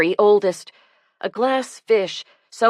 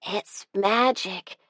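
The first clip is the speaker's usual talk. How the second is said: soft, slow, wondering sigh; 2 semitones higher, 6 dB quieter, slower than usual. A narrator's reading. Child gazing at the lit tree, softly sighing. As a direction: breathe it out in awe. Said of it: "sighed Zoe".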